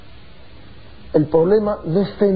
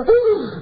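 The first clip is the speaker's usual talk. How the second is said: shocked gasp; 11 semitones higher than usual. Retold or imitated. Shocked at the leukemia request.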